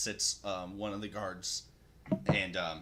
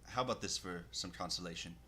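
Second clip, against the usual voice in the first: normal talking voice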